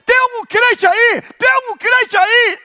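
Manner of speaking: high, loud call for a believer. Retold or imitated. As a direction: shout it to the whole crowd. Woman calling out in crowd. (grito chamando um crente)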